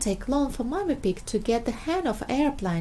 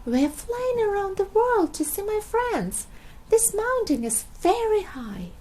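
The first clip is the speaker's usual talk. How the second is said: high; 10 semitones higher than usual. Acted. Peppa calling on mountain.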